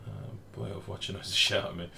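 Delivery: normal talking voice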